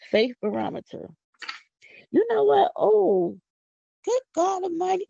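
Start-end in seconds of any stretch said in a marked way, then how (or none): 2.1-5.1 high, loud burst of excitement; rising church excitement; excited outburst. Real, not acted. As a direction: blurt it out, fired up. Getting worked up about the Lord.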